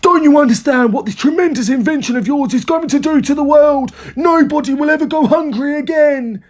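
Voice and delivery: high-pitched, fervent proclamation; worked-up excitement. A narrator's reading. Father telling George it will feed the world, worked up. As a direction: get carried away by your own vision. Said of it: "working himself up so much".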